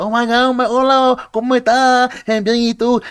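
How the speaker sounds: high, bright mock gush of greetings; overly excited Spanish greeting. Imitating an excited Dominican waitress's greeting. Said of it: "Spanish people are overly, overly, like, excited".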